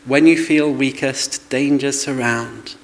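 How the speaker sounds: slowly recited hymn words; slow hymn lines. Retold or imitated. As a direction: say the hymn slowly, almost singing. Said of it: "a hymn we used to sing".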